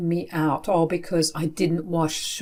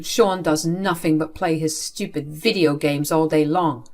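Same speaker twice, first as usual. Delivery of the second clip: ordinary talk, nothing marked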